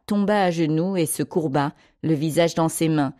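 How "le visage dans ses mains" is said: ordinary delivery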